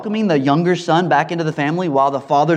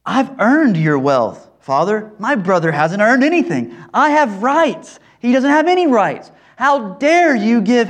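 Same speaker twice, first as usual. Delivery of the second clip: indignant outburst; 6 semitones higher, 4 dB louder than usual. Retold or imitated. Older son confronting his father, building to a loud indignant outburst. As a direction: demand your rights, building to outrage. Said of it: "He's saying".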